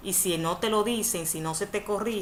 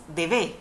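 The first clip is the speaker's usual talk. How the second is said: "debe" wrongly stressed on the final E, de-BÉ; stress on last syllable. Shown as a demonstration. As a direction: hit the second syllable of "debe". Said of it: "porque si no, dijéramos".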